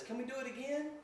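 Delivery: put-on high, rising childlike question; little girl's plea. Little girl asking as the game ends, high rising plea. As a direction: ask like a hopeful little girl. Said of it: "like, a little girl go".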